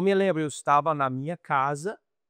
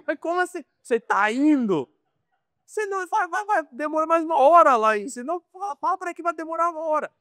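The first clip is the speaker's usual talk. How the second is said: high, exasperated incredulity, rushing the last line; the stunned American who can't believe it; shocked disbelief; 10 semitones higher, faster than usual. Retold or imitated. Missionary retelling his shocked reply to the bricklayer, high incredulous. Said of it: "chocado como americano".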